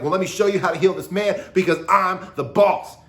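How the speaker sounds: hammed-up boss, shown as selfish anger; bossy show-off. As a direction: puff up like the self-important boss. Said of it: "selfish motivation".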